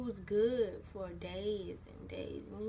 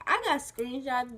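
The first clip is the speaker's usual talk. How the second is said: normal talking voice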